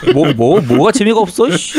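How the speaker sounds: 웃음 터진 채 되묻기; 어이없어 웃으며 항의; 웃으면서 따지기. Real, not acted. Friend's remark sets off laughter. (웃음 섞인 항의)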